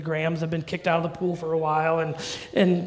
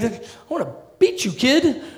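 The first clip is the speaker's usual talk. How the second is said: mock angry parent; 6 semitones higher, 4 dB louder than usual.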